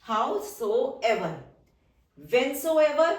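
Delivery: very drawn-out, syllable by syllable; slow dictation. Shown as a demonstration. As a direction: stretch it out for the class to write. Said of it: "teacher dictates word slowly". (बहुत धीमे खींचकर बोलना)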